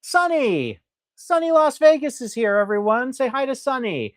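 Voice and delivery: welcoming a regular viewer in the chat, excited and high; excited greeting. Real, not acted. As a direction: hail a favourite viewer joining.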